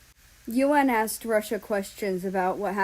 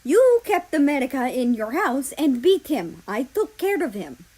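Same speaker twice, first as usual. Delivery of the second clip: angry accusation; 5 semitones higher, 7 dB louder than usual. Acted. Russia accusing Germany angrily.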